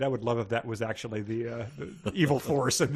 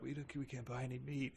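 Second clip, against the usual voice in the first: very soft retold mumble; meek refusal; 16 dB quieter than usual. Stoned twenty-two-year-olds turning down the meat guy, meek refusal. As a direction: barely voice it, too zonked to argue.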